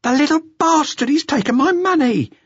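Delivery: high, loud furious shriek; outraged shriek. A narrator's reading. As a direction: shriek it at the empty handbag. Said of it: "and shrieked".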